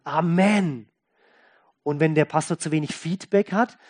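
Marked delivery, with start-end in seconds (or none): 0.0-0.9 firm Amen falling at the end; imitated emphatic group response; congregation's answer. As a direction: answer the preacher with a decisive Amen. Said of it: "die Gemeinde muss antworten".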